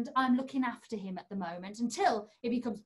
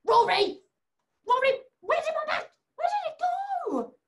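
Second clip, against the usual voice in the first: mock alarm; 13 semitones higher, 6 dB louder than usual. Hanky vanished again.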